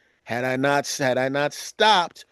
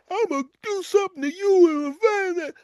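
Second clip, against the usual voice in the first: hammed-up road rage. Road rager shouting.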